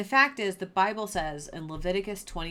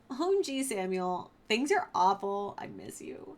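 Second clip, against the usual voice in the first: higher, mock-panicked teen gushing; whiny Saul; 5 semitones higher than usual. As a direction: play Saul as a frantic valley kid. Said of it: "Saul's like".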